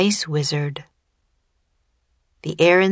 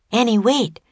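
higher, urgent call; 6 semitones higher than usual. A narrator's reading. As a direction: call after someone to stop. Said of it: "Jack called".

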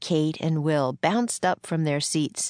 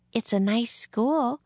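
politely, a higher lilting voice; higher-pitched, courteous remark; 7 semitones higher than usual. A narrator's reading. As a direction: say it as a polite compliment. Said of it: "Annie said politely".

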